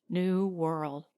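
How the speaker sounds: plain reading voice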